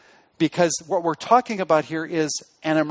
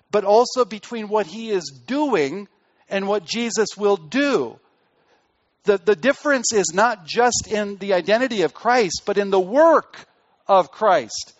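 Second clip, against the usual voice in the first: ordinary talk, nothing marked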